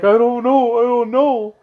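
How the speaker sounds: high-pitched helpless wail, mocking; whiny, high mock-distress voice; imitated distressed friend. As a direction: imitate an upset friend pleading ignorance. Voices panicked, crying friend's reply.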